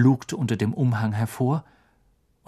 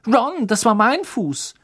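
high, loud, slow reproach; indignant girl; 9 semitones higher, 5 dB louder than usual. A narrator's reading. Hermine telling Ron off for stepping on her foot. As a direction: scold him, still smarting.

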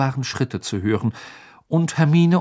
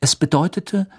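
ordinary delivery

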